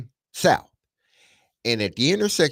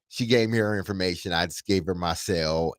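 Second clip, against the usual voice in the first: usual speaking voice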